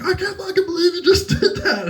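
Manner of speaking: very high mock disbelief breaking into a laugh; laughing imitation. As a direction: squeal his disbelief through laughter. Quoting the friend, laughing at it.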